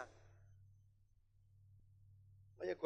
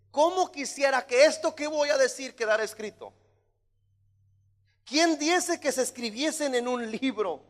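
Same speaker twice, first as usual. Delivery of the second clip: higher, louder declamation; exclaiming Job; 6 semitones higher, 8 dB louder than usual. Acted. Preacher as Job wishing his words carved, exclaiming. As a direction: cry out the wish, easing down at the end. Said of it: "signo de exclamación, decía Job".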